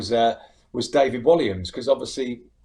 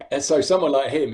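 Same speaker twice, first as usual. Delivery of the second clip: normal talking voice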